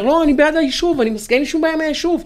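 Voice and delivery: high-pitched mimicked protest; mock protesting disclaimer. Quoting a protester who claims to back the settlement, mock insistence. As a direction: imitate someone insisting in a high voice.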